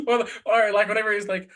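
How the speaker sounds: ordinary talk, nothing marked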